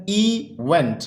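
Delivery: Event split in two, इ–वेंट; careful two-syllable breakdown of event. Shown as a demonstration. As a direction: draw out इ, then went as its own beat. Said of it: "ये दो syllable word है".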